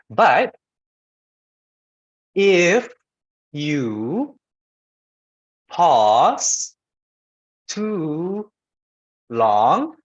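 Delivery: halting, word by word, long silences; pausing too long. Shown as a demonstration. Teacher demonstrating too long a pause, each word isolated. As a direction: leave a long gap after every drawn-out word. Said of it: "that's an example".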